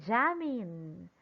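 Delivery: Jamin with a long JAM, falling away; stress on first syllable. Shown as a demonstration. Teacher repeating the name, first syllable stressed in Jamin. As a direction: lean on the A in Jamin. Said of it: "stress will be on top of letter A".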